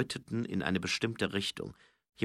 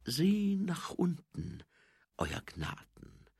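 sly threat; 8 semitones higher, much slower than usual. Acted. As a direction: savour the threat unhurriedly. Schaumlöffel hints at knife, sly threat. (gedehnte, hinterlistige Drohung)

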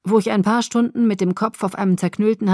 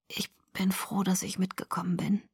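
very soft, breath-only voice; weak whisper; 10 dB quieter than usual. A narrator's reading. Sick boy reassures his friend about their outing, whispering. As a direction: whisper it with little strength. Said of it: "Seine Stimme war immer noch ein Flüstern".

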